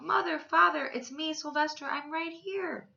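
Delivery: high, loud cry that slows as it fades; longing shout. Acted. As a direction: call out to his parents in a pitch far above the narration. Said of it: "How he wanted to shout".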